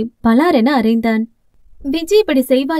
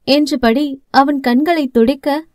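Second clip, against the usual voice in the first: usual speaking voice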